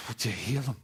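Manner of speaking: soft, quiet plea to God with a sigh; humble prayer. Retold or imitated. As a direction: pray simply for dad. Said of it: "It was just".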